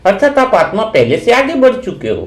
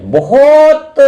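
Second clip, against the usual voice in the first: बहुत stretched long, higher pitched; emphatic stress; 8 semitones higher, 3 dB louder, much slower than usual. Real, not acted. Repeats word for emphasis.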